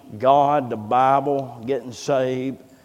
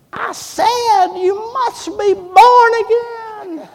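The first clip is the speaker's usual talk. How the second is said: very high, drawn-out holler; startling high-pitched shout, hammed up; sudden preacher's yell; 9 dB louder, slower than usual. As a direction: bellow it slowly, stretching every word. Coworker pounces in restroom.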